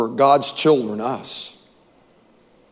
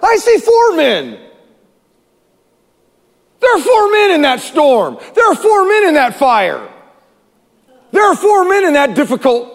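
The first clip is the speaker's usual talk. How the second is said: stunned king crying out; astonished shout; 8 semitones higher than usual. Acted. King shouting to his advisors in astonishment. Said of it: "Nebuchadnezzar shouted".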